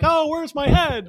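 slow, high confused voice; mock headless victim. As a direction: play the lost head, high and slow.

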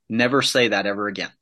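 ordinary talk

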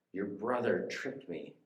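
normal talking voice

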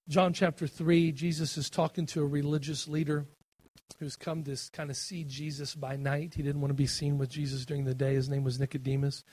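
ordinary delivery

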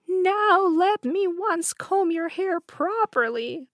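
high, slow wheedling crone; evil queen. A narrator's reading. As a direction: coax her closer. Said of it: "the old woman said".

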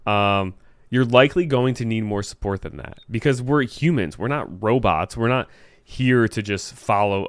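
ordinary delivery

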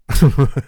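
short loud burst of laughter. Real, not acted. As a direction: crack up at the punchline. Laughs after his punchline.

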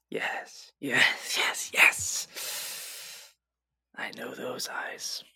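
hushed whisper of recognition; knowing, confidential whisper; secretive whisper. Acted. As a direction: whisper it privately, just for one student. Teacher privately recognises a student's family.

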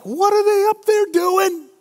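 high, indignant mock whine; a high, hammed-up griping disciple; jealous disciples. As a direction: squeak out the complaint like a jealous disciple.

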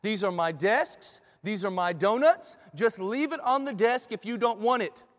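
stern. Acted. Professor to a protesting student about his class, stern. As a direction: lay down the law, each phrase set apart. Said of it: "Doctor Christensen said sternly".

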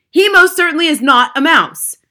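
very loud, high contradiction; indignant shout. A narrator's reading. Father contradicting grandmother about his son, indignant shout. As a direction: shout her down. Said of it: "shouted Mister Jenkins".

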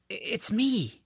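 put-on a touch of a shy boy reassuring a creature; George, hesitant. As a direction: stumble over the start, unsure. George introduces himself to hatchling.

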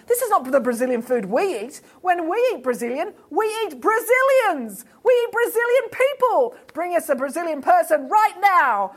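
high-pitched piranha tirade, climbing in indignation; rude, demanding. A narrator's reading. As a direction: build to a high, insistent demand. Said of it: "very rude and ungrateful".

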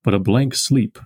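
slow, measured recital of the verse; poem reading. Acted. Lecturer rereading the poem for the class, slow and measured. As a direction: read it with the weight of the meter.

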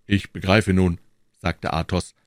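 ordinary delivery

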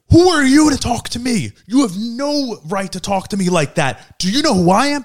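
high-pitched, haughty retold outrage; indignant woman. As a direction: imitate an affronted stranger pulling rank. Imitating indignant woman in quarrel.

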